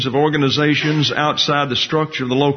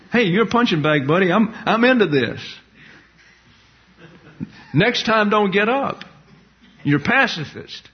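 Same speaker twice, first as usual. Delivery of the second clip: mock bully taunt; 7 semitones higher than usual. Taunting a knocked-down pacifist.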